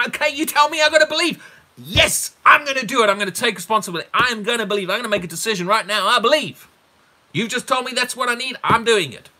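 high, loud, determined outburst; acted crying out. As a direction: shout it like a vow made on the spot. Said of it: "cried out and said with tears".

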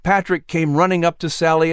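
usual speaking voice

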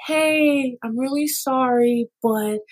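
high, slow and gently apologetic; sweetly sorry, so no one feels attacked; apologetic soft complaint. Shown as a demonstration. To the waiter, pointing out a wrong order, high and gently apologetic. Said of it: "if you say it in a way that sounds like".

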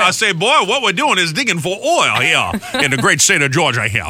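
I say, I say like a cartoon rooster; Foghorn Leghorn impression. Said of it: "Like Foghorn Leghorn".

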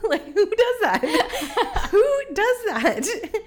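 high, laughing, disbelieving; cracking up at herself. Real, not acted. Laughing at her own behavior.

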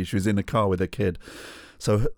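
usual speaking voice

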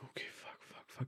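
whispered panicked swearing. Retold or imitated. Panicked reaction to the sale timer.

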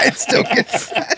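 breathy laugh through the words. Real, not acted. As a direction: say it while cracking up. Joke reply while laughing.

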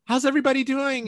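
loud, high, fast crowd greeting; stage announcer call-out. Retold or imitated. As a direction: hype up a stadium crowd. Concert intro, calling to crowd.

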